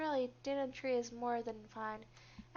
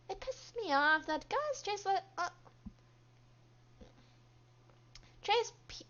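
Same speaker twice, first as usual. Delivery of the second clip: pitched-up, swinging voice of an aggrieved rival; grating, resentful coworker; 5 semitones higher, 5 dB louder than usual. Acted. Coworker resents girls who barely try, grating rant.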